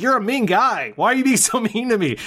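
high, whiny mock complaint with a laugh; mock-hurt drunk friend whining. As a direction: play the wounded pal pleading. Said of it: "He's just like".

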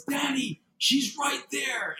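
put-on high child voice, drawn out; imitating young daughter. Daughter points out the figure to father, imitated high voice. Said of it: "And she says".

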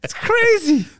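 loud, high laughing exclamation. Real, not acted. Host laughing at the absurd cancer story. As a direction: burst out laughing.

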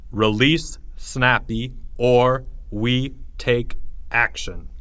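slow, word-by-word threat; ominous sign reading. A narrator's reading. New sign on the nature room door, ominous. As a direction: pause between the words like a ransom note.

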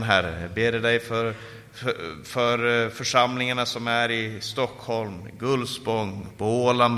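subdued, heartfelt intercession; earnest prayer. Real, not acted. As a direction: pray aloud for the congregation, low and steady. Leads the closing prayer.

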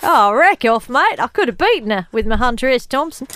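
terrible Australian accent, hammed up; Mark Little. Quiz host impersonating a celebrity listening in, terrible Australian accent. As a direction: lay on a bad Australian accent. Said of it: "terrible accents are my speciality".

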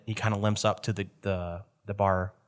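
ordinary delivery

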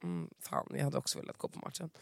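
hushed envious aside; mock envious whisper. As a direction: whisper it like secret envy. Retold envious reply, hushed.

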